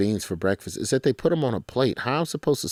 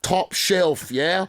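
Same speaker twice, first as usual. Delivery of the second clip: a higher, hammed-up British accent; 8 semitones higher, much slower than usual. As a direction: play the British fan with a chirpy higher British accent. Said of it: "You, in the accent".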